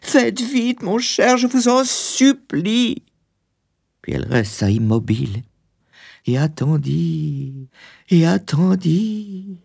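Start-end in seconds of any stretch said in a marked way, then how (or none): 0.0-3.0 very high, urgent plea with a drawn-out ending; high, desperate entreaty; begging wife. Acted. Wife begging husband to hurry. 6.2-9.6 slow, high, suspended repetition; lingering, raised storyteller's pitch; drawn-out waiting. A narrator's reading. Narrator stretches the long wait.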